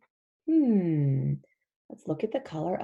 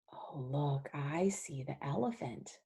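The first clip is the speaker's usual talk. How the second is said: hushed aside; 6 semitones lower, 8 dB quieter than usual. Acted. Sneaky aside to child.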